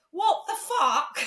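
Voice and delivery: laughing high mock outburst; laughing disbelief. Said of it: "She just rings me and goes".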